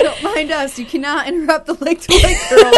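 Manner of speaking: loud, laughing mock indignation; giggling, playfully outraged. Real, not acted.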